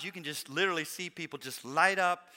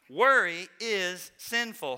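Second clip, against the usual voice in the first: slow, loud, every word weighted; deliberate preaching emphasis; emphatic declaration; 2 semitones higher, 7 dB louder, much slower than usual. Real, not acted. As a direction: hammer the point home. Preacher drives home key point.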